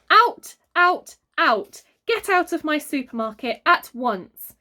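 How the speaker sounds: high, loud furious order; shouting. A narrator's reading. The manager throws the family out, shouting. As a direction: bellow them out of the shop. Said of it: "she shouted".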